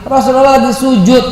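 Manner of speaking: loud, high, impassioned sermon; fiery preaching. Acted. Preacher rebuking modern parents, loud and impassioned. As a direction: preach it with rising fervor.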